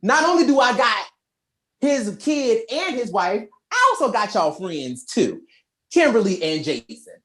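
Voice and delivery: high, smug villain taunt; gloating villain. Retold or imitated. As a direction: rub it in with a sneer. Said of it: "Divatox is like".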